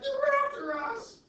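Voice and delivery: very high, loud, slow cry of alarm; mock panic. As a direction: wail it like paranoid villagers. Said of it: "they're like".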